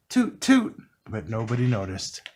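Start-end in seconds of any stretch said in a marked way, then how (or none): 0.1-0.8 high, louder toot; tugboat toot. A narrator's reading. Tugboat toots in the busy harbor, high and louder. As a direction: toot for attention. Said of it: "Scuffy said".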